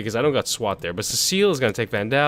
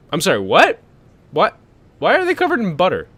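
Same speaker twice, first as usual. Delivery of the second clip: baffled disbelief; 6 semitones higher, 6 dB louder than usual. Real, not acted. Surfers covered in butter.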